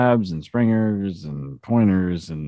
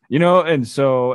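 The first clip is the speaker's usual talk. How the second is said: normal talking voice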